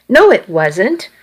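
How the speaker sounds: ordinary delivery, nothing marked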